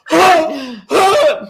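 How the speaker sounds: high mock screaming kids; mock kid screams. Brother and I screaming at mom's silhouette, high mock squeals. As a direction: squeal like scared little kids. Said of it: "And we're like".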